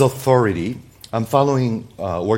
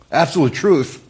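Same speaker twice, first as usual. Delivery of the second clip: higher, incredulous objection; scoffing disbelief; 5 semitones higher, 3 dB louder than usual. Acted. Unbeliever objecting to the claim, scoffing. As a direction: toss it back like a doubter. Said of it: "if you're not a believer".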